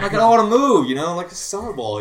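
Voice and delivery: a high, loud gripe; annoyed player griping about moving; worked up. Retold or imitated. Getting annoyed at playing outfield.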